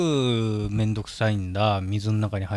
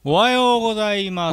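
loud morning greeting; 9 semitones higher, 6 dB louder than usual. Pretend. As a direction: call out a long wake-up greeting. Waking call after sleeping in game. (大声で伸ばす朝の挨拶)

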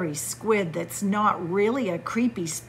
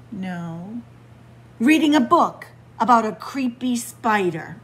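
ordinary delivery, nothing marked

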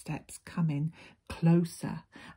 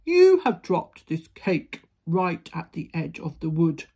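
big, loud giant voice; booming giant; 8 dB louder than usual. A narrator's reading. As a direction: boom it out like a huge giant. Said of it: "explained the giant".

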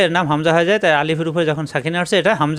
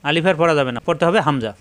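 ordinary delivery

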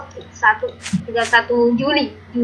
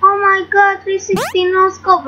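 excited; 7 semitones higher than usual. Real, not acted. Replaying the amazing shot.

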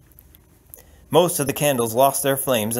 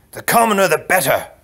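louder, higher retort; emphatic prince; 6 semitones higher, 6 dB louder than usual. Acted. Prince answering the count's doubt, emphatic retort. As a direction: throw it back with force.

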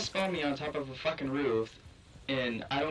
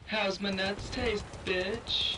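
taunt; 7 semitones higher, slower than usual. Acted. Taunting an enemy after a kill.

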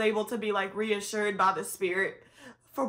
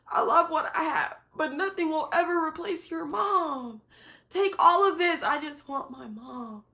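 pleading with God; 7 semitones higher than usual. Retold or imitated. Retelling her late-night prayer, pleading then fading. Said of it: "me trying not to get angry".

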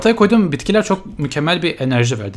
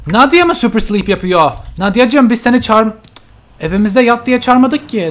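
indignant; 8 semitones higher, 7 dB louder than usual. Real, not acted. Indignant at sim.

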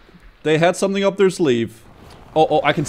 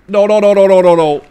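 panicked shout; 9 dB louder than usual. Real, not acted. Panic in game.

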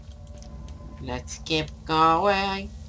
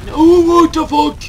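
high loud shocked cry; startled shout; 11 semitones higher, 14 dB louder than usual. Real, not acted. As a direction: yell it out in shock. Startled by game event.

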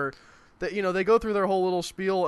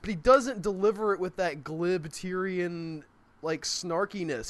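ordinary talk, nothing marked

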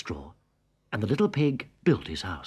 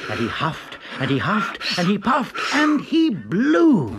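building dramatic blowing; 9 semitones higher, 7 dB louder than usual. A narrator's reading. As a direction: build up, straining harder.

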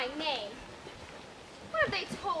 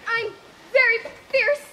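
mock fierce; 6 semitones higher, 8 dB louder than usual. Cowardly lion bluffs at stranger.

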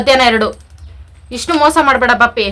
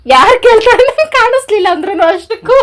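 high, loud, drawn-out exclamation; slow, high-pitched outcry ending in a laugh; laughing retort; 9 semitones higher, 6 dB louder, slower than usual. Real, not acted. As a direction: stretch the words in a raised voice, breaking into laughter. Wife retorts to teasing husband, laughing.

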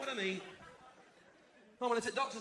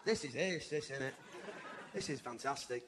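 low aside; 8 semitones lower, 7 dB quieter than usual. Acted. Low quiet aside to mum.

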